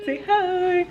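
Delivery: high, loud, drawn-out pet greeting; pet talk voice. Real, not acted. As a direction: coo it at a dog. Greeting the dog.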